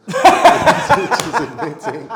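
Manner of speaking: high, loud laughing words; punchline lost in a high laughing fit; laughing hard. Real, not acted. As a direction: squeeze the words out through laughter.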